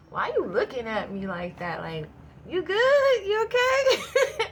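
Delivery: slow, high and loud mock concern; drawn-out worried checking on her, then cracking up; stretched high worried questions, near laughing; concerned friend. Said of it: "and I'm like".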